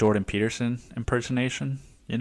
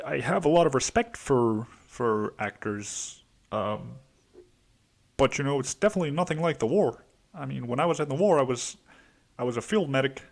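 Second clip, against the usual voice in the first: a lightly voiced Adam Driver, higher and quick; rough Adam Driver imitation, a touch higher; Adam Driver impression; 4 semitones higher than usual. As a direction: loose mock Adam Driver musing. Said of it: "it's so fucking fun to do Adam Driver impersonation".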